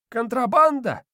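put-on high, cackling old house spirit; squeaky little old spirit chuckling; domovoi voice. A narrator's reading. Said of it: "хохотнул домовой".

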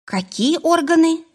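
high-pitched, puzzled question; baffled. A narrator's reading. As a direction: ask in sheer bewilderment. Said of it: "не поняла Варвара".

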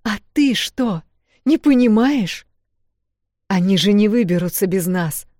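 heated retort. Acted. Вэл arguing back at her sister, angry shout.

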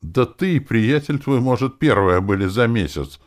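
high, loud, bitter complaint; aggrieved outburst; bitter exclamation. A narrator's reading. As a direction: cry out with bitterness. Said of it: "горько воскликнула её подружка".

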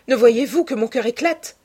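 heartbroken outburst. A narrator's reading. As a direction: cry out with a breaking heart. Heartbroken youth begs to be alone. (éclat de désespoir)

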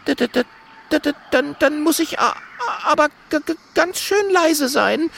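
very high, stuttering monkey; high-pitched scared little voice; frightened stammer. Acted. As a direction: stammer nervously on the first sounds. Frightened monkey agrees to climb.

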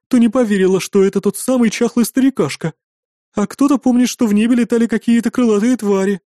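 high, loud character voice; excitable hostess storytelling; innkeeper telling events. A narrator's reading. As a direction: carry on an animated account. Innkeeper continues her tale.

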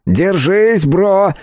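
urgent high call; shouting. A narrator's reading. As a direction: yell it out to a friend. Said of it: "крикнул в ответ эльф".

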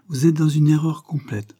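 gaily. A narrator's reading. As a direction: answer gaily, brushing it off. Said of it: "répondit-elle gaiement".

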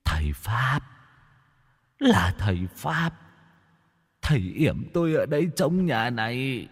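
soft, far-off ghost voice, sobbing; weeping ghost. A narrator's reading. The beaten ghost confesses to the exorcist, weeping softly. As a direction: whimper the confession. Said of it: "mếu máo khóc".

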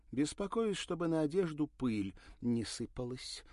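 high, loud and biting; sarcastic. A narrator's reading. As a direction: needle him sweetly. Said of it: "язвительно заметила Ирина".

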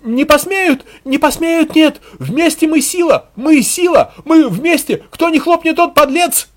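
high, loud frantic chanting; out-shout the panic with slogans. A narrator's reading. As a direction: demagogue crying out over a crowd. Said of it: "Ещё громче возопил Мудель".

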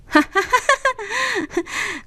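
high, scornful burst of laughter; mocking laugh. A narrator's reading. Daughter laughing at her father, mocking. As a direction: laugh arrogantly in his face. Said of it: "Nga phá lên cười ngạo".

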